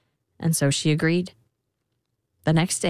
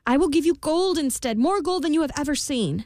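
high, anxious queen offering gold; pleading queen; 9 semitones higher than usual. A narrator's reading. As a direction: plead with the imp. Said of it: "She said".

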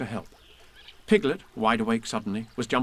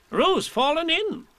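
high, slow alarmed call; alarmed cry; 4 dB louder, slower than usual. Acted. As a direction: cry out the news. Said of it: "cried Rabbit".

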